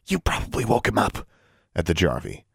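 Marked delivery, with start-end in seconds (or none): 0.0-1.2 mild hiss, higher pitch. A narrator's reading. Girl running down the hall scolds creature, hissing. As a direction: hiss it at a troublesome pet.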